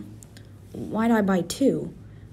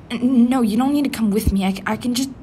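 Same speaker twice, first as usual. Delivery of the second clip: flustered protest; 3 semitones higher, 6 dB louder, faster than usual. Acted. Panicked refusal to company.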